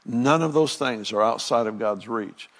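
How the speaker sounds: ordinary talk, nothing marked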